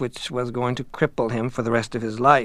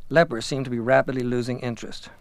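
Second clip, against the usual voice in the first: ordinary delivery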